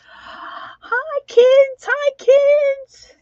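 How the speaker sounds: grandma's high, loud sing-song hello; excited greeting. Real, not acted. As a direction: call out delightedly to the grandkids.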